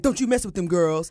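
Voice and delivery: lightly voiced tough diva, a laugh in it; mock-sassy warning to the girls. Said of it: "[name] was like".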